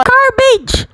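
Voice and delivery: shrill little game voice yelling garbage; high squeaky insult. Acted. Customer insults the song. (matinis na sigaw ng karakter sa laro)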